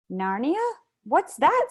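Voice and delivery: put-on high puzzled girl asking; puzzled surprise. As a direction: ask it high and baffled. Lucy puzzled.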